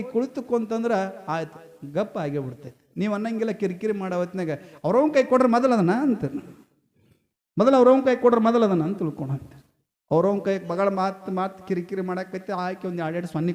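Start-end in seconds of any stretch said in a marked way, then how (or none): 4.8-5.9 loud, rapid mock snapping; exasperated parent. Preacher imitating parents fed up with a fussing child, exasperated snapping. 7.6-8.8 loud, rapid mock snapping; exasperated parent. Preacher imitating parents fed up with a fussing child, exasperated snapping.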